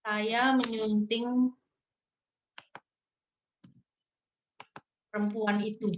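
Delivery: slow and deliberate, stress on "Saya"; slow example sentence. Shown as a demonstration. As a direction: spell out "Saya menyunting perempuan itu" word by word for students. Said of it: "Nah, menyunting itu edit loh".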